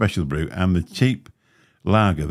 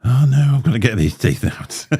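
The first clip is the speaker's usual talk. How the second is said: alarmed inner voice; 4 semitones higher, 4 dB louder than usual. Retold or imitated. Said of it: "I'm thinking".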